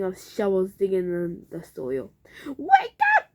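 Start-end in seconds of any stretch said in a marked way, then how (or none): 2.4-3.2 high frantic shout; urgent alarmed shout. A narrator's reading. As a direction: yell to wake them. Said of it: "he shouted".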